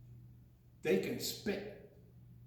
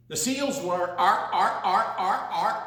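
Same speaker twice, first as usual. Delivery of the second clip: seal barking; 6 dB louder than usual. Acted. Seal noises.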